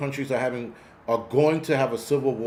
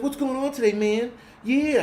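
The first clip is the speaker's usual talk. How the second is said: put-on much higher, bouncy kid voice; mock kid voice, much higher; imitating the hyper kid; 12 semitones higher than usual. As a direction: play the fidgety breakdancing kid, way up high. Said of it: "He's like".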